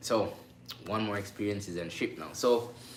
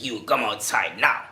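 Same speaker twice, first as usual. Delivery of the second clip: loud, slow guard's command; acted stern order; 3 semitones higher, 9 dB louder than usual. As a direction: order a crewman out, loud and deliberate. Guard orders him out of cabin.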